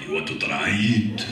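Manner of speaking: loud, deep giant's offer; Andre. Retold or imitated. Giant offering his co-star a ride, booming and deep. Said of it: "Hi, Andre".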